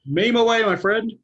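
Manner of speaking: high, loud and cheery; bursting in with sudden energy; excited. Real, not acted. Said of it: "Oh, it's not that late, is it, [name]?".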